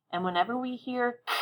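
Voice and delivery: ordinary talk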